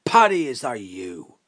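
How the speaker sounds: big drawn-out call, high on the name; booming hail across a field; shouting down. Acted. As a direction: holler down to someone outside. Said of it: "the man shouts down".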